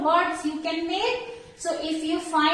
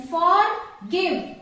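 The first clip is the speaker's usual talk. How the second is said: forgive slowly split as for, give; syllable by syllable. Shown as a demonstration. Teacher showing two syllables, forgive slowly broken apart. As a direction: stretch forgive into two separate beats. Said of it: "two syllables".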